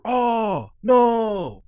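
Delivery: high, loud dismayed cry; a real groan of dismay at the game; Oh no, pitched high and loud in real dismay. Real, not acted. Game event goes wrong.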